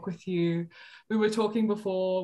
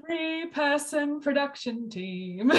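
wry deadpan; 9 semitones higher, slower than usual. Real, not acted. Wry joke about tiny team.

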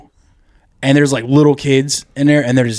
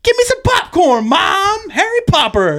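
put-on much higher, slow childish whine; little kid voice. Kid in the theater asking his mom, childish. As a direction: talk like an oblivious small child. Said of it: "He follows up with".

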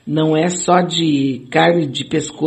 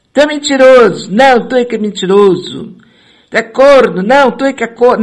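loud, higher insult shouting; mock shouting match; 6 semitones higher, 8 dB louder than usual. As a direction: hurl insults back and forth. Imagined debate insult exchange.

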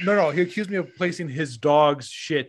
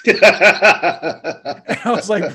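words drawn out through real laughter; can't stop laughing; 2 semitones higher, 9 dB louder, much slower than usual. Real, not acted.

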